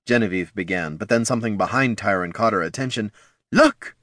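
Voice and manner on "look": sudden high exclamation; startled cry. A narrator's reading. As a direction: cry out, pointing. Genevieve spots something.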